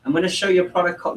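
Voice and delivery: fast, bright sales opener; upbeat pitch. Shown as a demonstration. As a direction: sell it with energy. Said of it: "this is how I say it".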